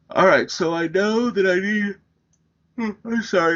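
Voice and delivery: words stretched through a real yawn. Real, not acted. Teacher yawning mid-sentence while setting up the table. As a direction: talk through a yawn you can't hold back. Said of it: "I don't know why I'm yawning".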